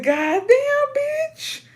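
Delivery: very high squeaky disbelief; stunned. Retold or imitated. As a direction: squeal it in mock shock. Retold shock at lady's put-down.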